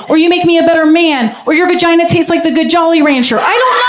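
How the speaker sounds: high excited rant; giddy. Real, not acted. Gushing high to the ladies in the audience.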